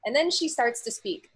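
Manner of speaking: usual speaking voice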